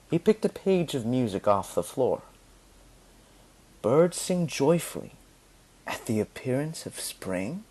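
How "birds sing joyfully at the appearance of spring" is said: soft, fading, thoughtful question; quiet musing. A narrator's reading. Vivaldi reading Candida's poem, quiet and thoughtful. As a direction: read it softly, intrigued. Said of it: "he asked".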